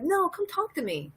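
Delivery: high, imploring mock invitation; mock coaxing. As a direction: plead playfully as an eager stranger. Mock stranger coaxing someone moody.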